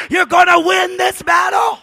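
loud, high preacher's shout; triumphant shouted declaration; shouted promise. Shown as a demonstration. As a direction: hurl it out to the back row. Shouted promise before quiet repeat.

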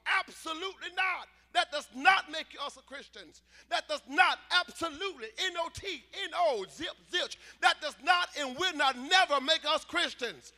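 emphatic shouted preaching, punching the key words; full-force preaching. Real, not acted. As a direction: thunder it out to the congregation.

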